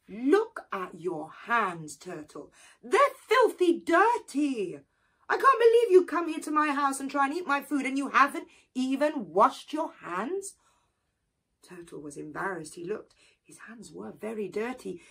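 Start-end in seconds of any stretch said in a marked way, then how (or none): 0.1-10.5 louder, higher indignant telling-off; scolding. Acted. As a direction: scold the guest for dirty hands. Anansi scolds dirty hands.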